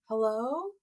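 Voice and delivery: quiet, offhand hello; mock a lazy agent answering; a dull, barely-there hello; limp unprofessional hello. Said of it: "agents pick up their phone and they say".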